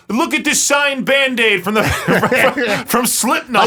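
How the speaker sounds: high, excited put-on voice broken by laughter; mock showing-off. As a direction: brag in a squeaky mock voice, giggling. Acts out man bitten by beaver.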